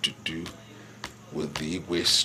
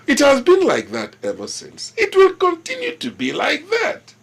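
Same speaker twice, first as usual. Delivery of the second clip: loud, high, vehement declaration; angry insistence; 11 dB louder than usual. Acted. Ruler defending the ancestral right to the throne, angrily insistent. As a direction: lay down the law at full, raised voice.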